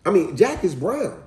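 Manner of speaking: put-on little boy. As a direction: imitate a boy correcting himself. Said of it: "He said".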